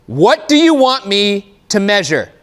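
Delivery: acted exasperation demanding an answer; mock angry demand. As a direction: spell it out in put-on fury. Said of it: "I'm not actually angry at you".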